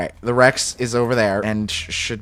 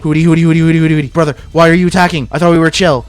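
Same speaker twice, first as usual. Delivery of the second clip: loud, higher pleading yell at his pet; frantic shouting; 5 semitones higher, 9 dB louder, faster than usual. Real, not acted. Player yelling at his attacking pet Hootie, loud and frantic. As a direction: shout at a friend who betrayed you. Pet dino turns on him.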